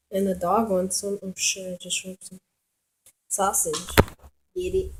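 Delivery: usual speaking voice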